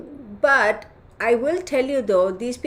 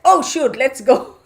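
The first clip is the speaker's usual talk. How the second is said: a loud, higher dog voice, suddenly eager; 4 semitones higher, 7 dB louder than usual. Retold or imitated. The dog hears the code word and hurries, eager voice. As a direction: voice the dog rushing off. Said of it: "And he was like".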